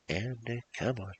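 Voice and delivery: usual speaking voice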